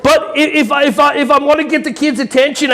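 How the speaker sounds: ordinary talk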